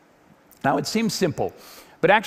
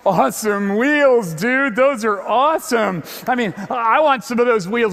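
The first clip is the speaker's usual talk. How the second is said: a hint of a stoked passerby, higher and louder; lightly voiced dude, higher; impressed dude; 6 semitones higher, 5 dB louder than usual. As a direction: imitate a guy admiring the wheels. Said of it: "People going".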